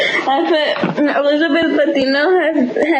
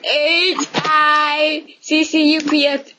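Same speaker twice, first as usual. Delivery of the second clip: spelling it out; slower than usual. Shown as a demonstration. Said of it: "How do you spell hiccup?".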